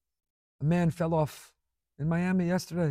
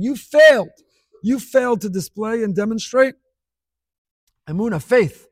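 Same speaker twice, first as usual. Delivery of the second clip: loud, higher, thundering you failed; fiery rebuke; 5 semitones higher, 9 dB louder than usual. Real, not acted. The preacher voicing Moshe's rebuke of the people, thundering.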